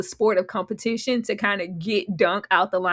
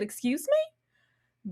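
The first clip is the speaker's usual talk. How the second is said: indignant; 6 semitones higher, 6 dB quieter, much slower than usual. Retold or imitated. Retold indignant reply.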